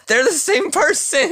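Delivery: high, loud laughing outburst; shouting. Real, not acted. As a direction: blurt it out, laughing. Shouting during a heated debate.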